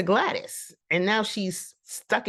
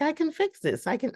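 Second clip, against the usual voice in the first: high, eager lady voice; eager wife; 13 semitones higher than usual. Retold or imitated. Wife reassuring her husband, high and eager. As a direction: pipe it up brightly and eagerly.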